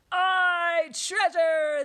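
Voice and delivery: long, high, loud pirate cheer; crew shouting. A narrator's reading. Pirates cheer for the treasure, shouting. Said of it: "the others shouted".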